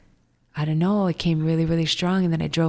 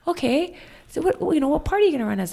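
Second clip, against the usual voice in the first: mock adviser quote; 10 semitones higher, faster than usual. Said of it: "I was like".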